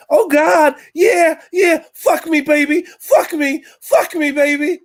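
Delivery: loud high American moaning; hammed-up American sex voice; American accent. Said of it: "Her whole sex thing is in a different accent".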